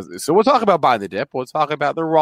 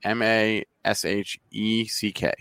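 spelling it out; 5 semitones lower, 6 dB quieter than usual. Real, not acted. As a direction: spell the name out one letter at a time. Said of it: "How do, how do you spell that?".

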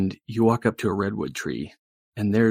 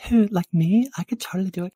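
put-on high, excited girly voice; volunteer in a high girlish gush; high-pitched eager girl; gushing valley girl, eager; 13 semitones higher, 4 dB louder than usual.

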